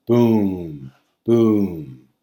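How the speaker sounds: two slow heavy booms like pouring water; libation thuds. Shown as a demonstration. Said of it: "so sponde is two shorts".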